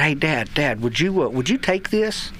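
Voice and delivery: usual speaking voice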